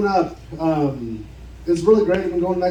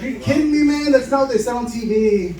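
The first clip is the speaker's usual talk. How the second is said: pitched-up, exasperated disbelief; a comic indignant whine at the pharmacist; mock outrage; 6 semitones higher than usual. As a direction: play it indignant and betrayed. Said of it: "And I was pissed".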